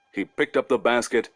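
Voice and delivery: plain reading voice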